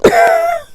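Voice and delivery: a very high, broken teary laugh-cry; tearful cry. Real, not acted. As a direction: break into a short high sob of emotion. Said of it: "give me a minute".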